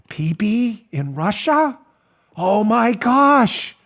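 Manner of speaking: hushed, very slow mock astonishment; Rod Rosenstein. Host plays Rosenstein reading the dossier, mock-stunned. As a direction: stretch it out in stunned disbelief. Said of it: "Here's Rosenstein".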